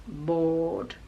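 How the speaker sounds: board with no R, slow; Australian board, no R; non-rhotic board. Shown as a demonstration. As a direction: drop the R in board. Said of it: "we don't pronounce the R".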